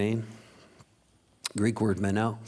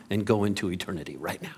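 whispered aside; 3 semitones lower, 3 dB quieter than usual. Real, not acted. Preacher teases congregation about popping into eternity, whispered. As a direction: drop to a whisper on the punchline.

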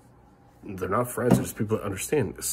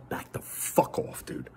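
slow whispered warning; much slower than usual. Real, not acted. Warning to anonymous flaggers.

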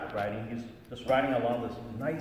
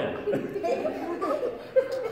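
breathy high-pitched laugh; 4 dB louder than usual. Real, not acted. Laughing at own joke to the audience, high airy laugh.

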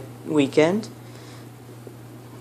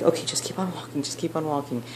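much softer, hushed keep walking; hushed urging; 11 dB quieter than usual. Retold or imitated. Sister-in-law quietly urging the group past the drunk woman, hushed. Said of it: "one of them is like".

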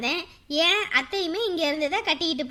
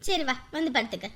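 put-on softer, lower comforting words to a crying girl; aunt calming the crying bride, tender; soothing; 7 semitones lower, 5 dB quieter than usual. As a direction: hush her tears gently.

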